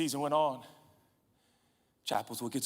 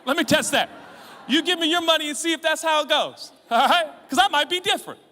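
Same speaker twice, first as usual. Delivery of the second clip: higher, loud mock-greedy challenge; cheeky shout; 12 semitones higher, 10 dB louder than usual. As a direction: shout a playful dare. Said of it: "you're like".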